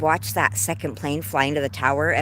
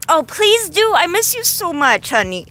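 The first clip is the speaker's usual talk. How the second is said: high, loud burst of affection; excited gushing; 11 semitones higher, 5 dB louder than usual. Real, not acted. Streamer to missed best friend, excited gushing. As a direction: squeal it to a dearly missed friend.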